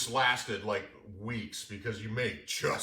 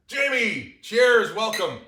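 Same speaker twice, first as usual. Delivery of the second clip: excited toast; 9 semitones higher, 10 dB louder, much slower than usual. Real, not acted. Toasting the guest.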